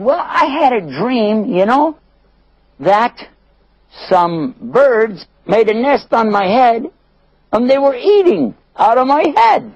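high-pitched voice telling a strange dream; high, halting storyteller-prisoner voice; puzzled dreamer. Acted. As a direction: recount it slowly, high and wondering. Prisoner recounts his strange dream.

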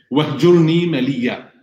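Quranic recitation. Acted. Recites Quranic verse in Arabic.

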